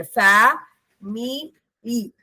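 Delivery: "ഫാ മി ഇ" with a clear pause after each syllable; isolated syllables "ഫാ", "മി", "ഇ". Shown as a demonstration. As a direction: count out "ഫാ മി ഇ" one beat at a time. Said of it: "ഫാമിലിയിൽ മൂന്നെണ്ണം ഉണ്ട്".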